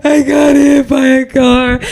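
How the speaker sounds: high, slow tearful wail; hammed-up crying confession; imitated crying. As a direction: sob it down the phone to mom. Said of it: "I'm crying on the phone to her".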